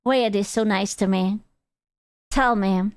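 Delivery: ordinary delivery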